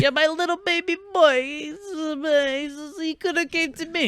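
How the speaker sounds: very high falsetto mother voice; mock doting mother. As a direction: fuss over your boy in squeaky falsetto.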